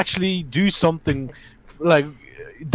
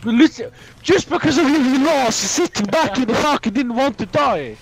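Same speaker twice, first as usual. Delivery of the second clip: raised, high-pitched angry rant; angry blaming; 5 semitones higher than usual. Real, not acted. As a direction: lay into a teammate for losing. Blaming teammate for lost game.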